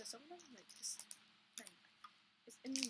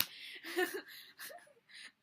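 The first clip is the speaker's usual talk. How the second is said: laugh; 10 semitones higher, 5 dB louder than usual. Real, not acted. Giggling before the story starts.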